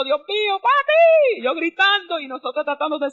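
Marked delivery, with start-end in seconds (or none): 0.0-1.4 retold scream. Daughter screaming for her father trapped under the bed, panicked yell. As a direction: cry out to papi as he lies under the bed. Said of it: "nosotros gritando".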